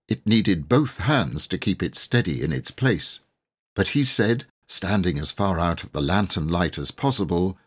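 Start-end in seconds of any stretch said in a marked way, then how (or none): none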